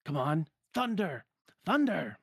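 high, insistent spell cry; Donald yelling. Acted. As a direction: urge the spell out, rising in pitch. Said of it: "waves his wand and yells".

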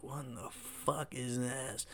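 low, slow, hushed disbelief; baffled aside. Retold or imitated. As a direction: mutter it slowly under your breath, baffled. Teen watching his friend worship, baffled.

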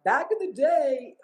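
put-on old lady voice. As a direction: play the nagging old lady reminiscing. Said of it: "now I sound like an old lady".

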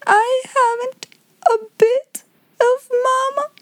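put-on very high little-girl bawling; lifted-up wail. A narrator's reading. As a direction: draw out each phrase in a childish lament. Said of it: "lift up her voice".

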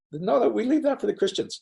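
playfully imitating a smug opt-out; joking mock refusal. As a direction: hand it off in a high, joking voice. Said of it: "And I'm joking".

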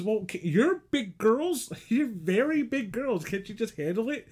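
high, slow teasing lecture; hammed-up boss talking down; mock scolding. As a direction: act out the boss scolding them, amused. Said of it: "And he goes".

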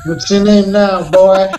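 hammed-up overseer's challenge; Roots overseer. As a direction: demand it like a parody slave master. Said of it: "are you doing a Roots reference?".

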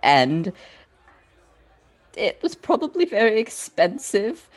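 tearful. Acted. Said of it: "Rowan's like".

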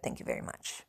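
dry whispered thank you; whispered sarcasm. Real, not acted. Sarcastic aside to herself.

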